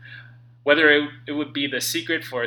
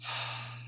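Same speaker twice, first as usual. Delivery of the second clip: slow unvoiced exhale to calm down; long sigh; 11 dB quieter than usual. Real, not acted. Author pausing to calm himself, soft sigh. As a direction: let the breath out softly. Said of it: "to just breathe".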